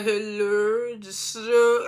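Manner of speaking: drawn-out mock French accent; slowed-down fake French. Quay imitated in French class, slowed-down fake French. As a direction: stretch each word, imitating bad French. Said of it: "trying to slow down English words to make them sound like they was French".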